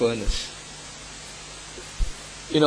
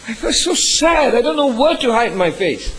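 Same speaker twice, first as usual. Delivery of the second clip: anguished; 9 semitones higher, 5 dB louder than usual. Real, not acted. Pastor recalling slander, ashamed.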